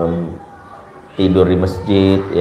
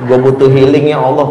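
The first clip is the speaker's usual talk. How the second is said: louder mock Jaksel-slang plea; hammed-up Jaksel slang prayer; 3 semitones higher, 5 dB louder than usual. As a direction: whine to God like a trendy South Jakarta kid. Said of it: "bahasa anak Jaksel".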